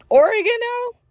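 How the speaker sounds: "Oregon" squeaked way up high in disbelief; incredulous. Real, not acted. Telling friends about the surprise job offer, incredulous high squeak. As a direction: shoot up high, can't believe it.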